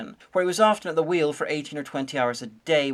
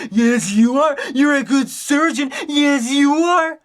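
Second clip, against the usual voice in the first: put-on much higher, louder squealing baby talk; excited dog praise; 10 semitones higher, 7 dB louder, slower than usual. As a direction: praise the good dog with delight, stretching the last words.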